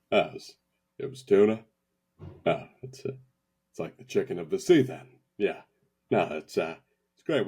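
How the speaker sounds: deadpan forced politeness; politely choking down a bad meal; Patrick Warburton. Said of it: "Patrick Warburton, trying to be nice about a bad tasting meal".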